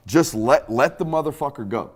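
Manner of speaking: normal talking voice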